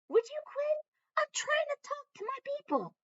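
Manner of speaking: high, exasperated scold at the dog. Real, not acted. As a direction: tell the pet off, high and fed up. Scolding the dog mid-video.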